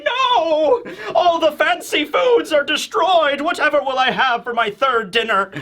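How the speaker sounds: high pompous wail, slowing; mock noble mourning his feast; wailing snob. Panicking Tenryubito nobles.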